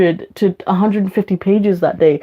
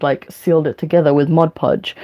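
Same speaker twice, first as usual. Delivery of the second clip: ordinary talk, nothing marked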